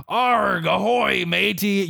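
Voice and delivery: jokey pirate accent, pitched well up. Shown as a demonstration. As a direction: sing out Arg ahoy matey like a cartoon pirate. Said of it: "whenever you're saying in a pirate accent".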